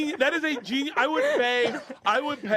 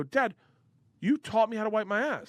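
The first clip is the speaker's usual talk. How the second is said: indignant retold protest; 8 semitones higher than usual. Retold protest to his father.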